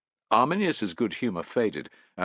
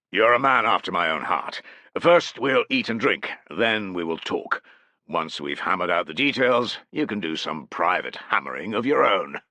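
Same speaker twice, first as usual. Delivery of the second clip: bluff chieftain voice; 3 semitones higher, 6 dB louder than usual. Acted. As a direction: boom it out like a lusty chieftain. Malavendus jovial toast.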